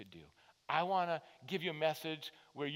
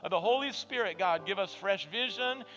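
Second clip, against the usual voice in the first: louder, higher pleading prayer; fervent closing prayer; 7 semitones higher, 9 dB louder than usual. Acted. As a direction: lift the congregation in prayer. Closing prayer at full force.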